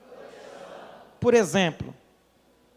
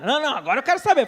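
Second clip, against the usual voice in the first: fast, louder, higher insisting; an alarmed husband demanding to hear the news; anxious insisting; 4 semitones higher, 4 dB louder, much faster than usual. Retold or imitated. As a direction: rush it out, anxious to know.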